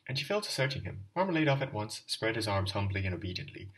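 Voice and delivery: ordinary delivery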